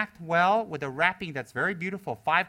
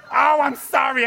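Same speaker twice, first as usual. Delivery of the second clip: high, loud flustered sorry; hammed-up apology; mock apologetic fan; 9 semitones higher, 10 dB louder, slower than usual. Incense burns him.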